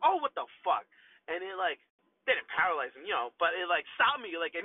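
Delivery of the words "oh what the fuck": high, loud sudden cry of shock; shocked outburst. Retold or imitated. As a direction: yelp as the pain hits. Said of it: "I'm like".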